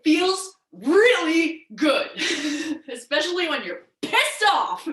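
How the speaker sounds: laughing through the words. Real, not acted. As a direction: let a real laugh break in as you speak. Laughing at her own remark.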